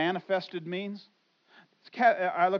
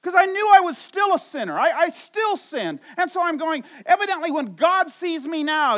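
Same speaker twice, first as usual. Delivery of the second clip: loud, high preaching voice; impassioned preaching; 9 semitones higher, 10 dB louder than usual. Acted. Pastor confessing his old shame to the church, loud preaching. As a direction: pour it out like a confession from the pulpit.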